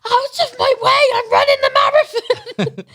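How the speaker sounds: very high, laughing, half-crying shout; parting tourists on the path, a high laughing shout; laughing mock shout. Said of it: "I was just like".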